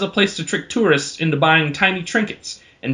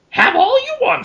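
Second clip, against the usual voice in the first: much higher, raspy troll voice; Yoda-like troll; 3 dB louder than usual. Acted. Bongo offers Samson the feast, high raspy troll. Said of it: "sounds kinda like Yoda".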